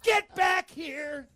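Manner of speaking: high, loud holler after a runaway; mock angry shout. Girlfriend catches him sneaking out, mock angry shout. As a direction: draw out each word in an imitated yell.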